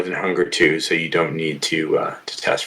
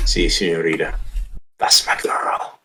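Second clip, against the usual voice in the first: sire's voice; 3 semitones lower, 3 dB quieter than usual. Acted. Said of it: "you can hear your sire's voice".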